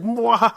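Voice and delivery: high, hammed-up villain's mwahaha; mock evil laugh. Mimicking smug meme-makers who trash science fiction, mock evil laugh. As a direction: cackle like a cartoon villain, in jest.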